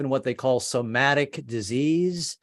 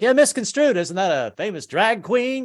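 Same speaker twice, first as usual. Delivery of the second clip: corny dad joke; 7 semitones higher than usual. Retold or imitated. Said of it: "tried to joke it off with the dad joke".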